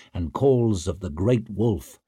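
usual speaking voice

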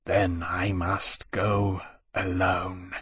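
very slow, soft and miserable; wretched whisper-soft resolve; said wretchedly. A narrator's reading. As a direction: barely breathe it out in despair. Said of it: "Temeraire said wretchedly".

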